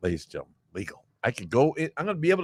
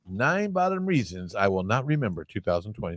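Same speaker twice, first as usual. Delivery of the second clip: usual speaking voice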